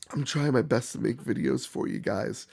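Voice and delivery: usual speaking voice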